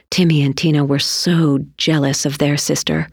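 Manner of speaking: plain reading voice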